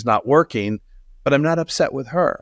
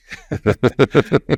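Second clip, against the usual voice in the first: short self-mocking chuckle; rueful laugh; 3 dB louder than usual. Real, not acted. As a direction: laugh at yourself. Laughing at own naivety.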